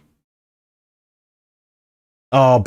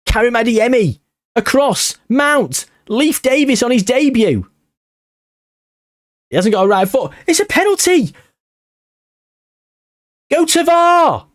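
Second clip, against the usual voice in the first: much higher, rising to a shouted appeal; excited play-by-play; 11 semitones higher than usual. Real, not acted. Gamer calling the attack, then shouting for a penalty review. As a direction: call the attack like a commentator, then demand a review.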